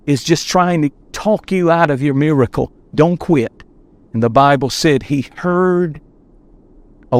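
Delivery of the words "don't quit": normal talking voice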